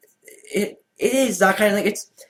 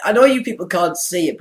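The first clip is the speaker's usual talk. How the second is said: normal talking voice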